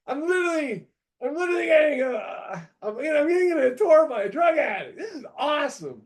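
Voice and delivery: high, loud thrilled disbelief; excited disbelief. Retold or imitated. Buyer touring a crack den, giddy and high-pitched. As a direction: gush like it's the best day ever. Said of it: "And I was like".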